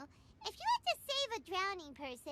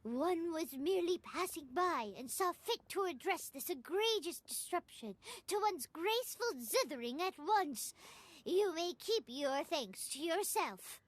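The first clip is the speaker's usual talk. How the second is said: lofty, lower-pitched impression of an aloof immortal; mock-haughty, self-important declamation; Cloud Retainer; 5 semitones lower than usual. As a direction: put on an imperious lower voice, slowing grandly at the end. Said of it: "very good impression of Cloud Retainer".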